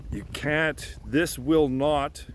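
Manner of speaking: ordinary talk, nothing marked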